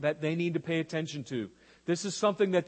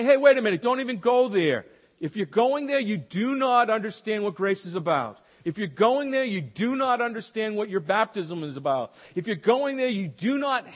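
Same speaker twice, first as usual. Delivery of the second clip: loud, firm warning; stern rebuke; 3 semitones higher, 4 dB louder than usual. Retold or imitated. Paul rebuking false teaching, loud stern warning. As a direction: stop them cold. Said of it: "And Paul say".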